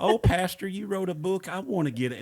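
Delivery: put-on old church lady gushing to her pastor; sweet old lady. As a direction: chirp it like a sweet church granny.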